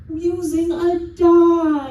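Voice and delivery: high, slow, sung-out reveal; theatrical drawn-out proclamation; mock-grand announcement. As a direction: stretch it out like a fanfare. Host announces die with flourish.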